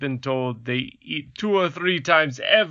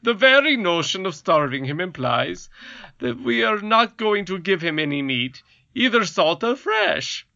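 pompous king, pitched up; goblin king; 7 semitones higher than usual. Acted. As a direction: lecture like a fussy king. King voiced by reader.

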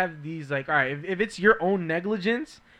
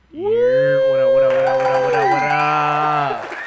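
hype shout; 10 dB louder than usual. Acted. Hype entrance greeting.

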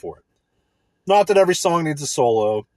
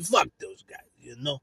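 ordinary talk

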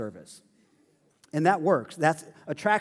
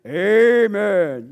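a much louder AMEN, called out long and falling; 2 semitones higher, 9 dB louder than usual. Shown as a demonstration. "Amen" called out loud by a congregant agreeing with the preacher. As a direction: sing out "Amen" the way the old congregation agreed with the pastor. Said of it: "we'd do that".

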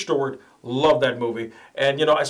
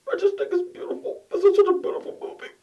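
mock crying; faster than usual. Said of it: "Are you crying?".

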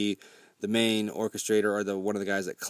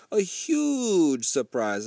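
drawn-out seductive boast; 5 semitones higher, much slower than usual. Retold or imitated. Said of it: "And then he responds with".

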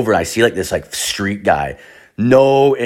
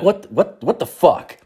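retold panic; 8 semitones higher than usual. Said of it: "I'm like".

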